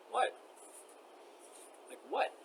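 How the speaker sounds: high-pitched, disbelieving what; incredulous. Real, not acted. Woman recounting police inaction, incredulous.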